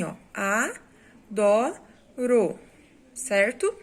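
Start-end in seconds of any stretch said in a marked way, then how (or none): 1.3-1.8 "Adoro" broken into clear syllables, louder; syllables split. Shown as a demonstration. Teacher shows how pupils separate the word, syllable by syllable. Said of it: "a forma com que ela separa é assim".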